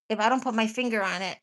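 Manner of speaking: ordinary delivery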